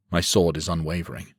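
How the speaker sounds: ordinary delivery, nothing marked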